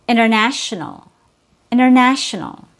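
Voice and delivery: "international" as "innernational", no T; T removed. Shown as a demonstration. Teacher giving an everyday example, disappearing T. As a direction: say "international" casually, dropping the first T. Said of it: "The T is removed between N".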